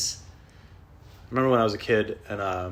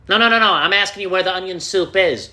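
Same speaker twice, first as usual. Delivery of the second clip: much higher, louder insistence; exasperated customer; 9 semitones higher, 9 dB louder than usual. Pretend. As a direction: correct the dumb clerk impatiently. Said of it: "customer correcting the clerk".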